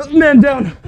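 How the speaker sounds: man down shout. Pretend. Callout, louder repeat.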